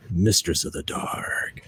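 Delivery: slow, hushed unvoiced whisper; dramatic whisper. The DM recites a character's title in a slow whisper. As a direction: breathe the title like a spooky secret. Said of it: "What does it always say?".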